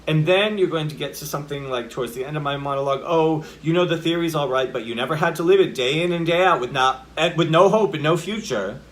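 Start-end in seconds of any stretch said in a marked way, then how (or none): 3.0-8.8 louder, higher outburst in one long sentence; bigger emotion. Acted. Character near end of monologue, big emotional outpouring. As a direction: build into a forceful protest without stopping. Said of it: "it gets bigger".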